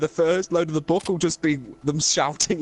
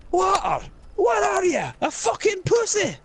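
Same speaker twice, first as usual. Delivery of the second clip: much higher, shouting Scottish disbelief; scornful Scottish shout. Acted. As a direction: sneer as if water were shameful. Character mocks water drinker.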